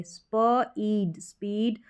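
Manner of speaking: स्प, pause, ईड, then joined as स्पीड; blending by parts. Shown as a demonstration. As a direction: slowly sound out "spuh... eed", then स्पीड whole. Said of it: "स्प पढ़ेंगे".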